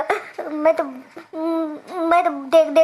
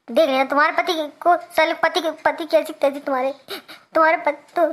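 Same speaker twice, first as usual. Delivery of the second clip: normal talking voice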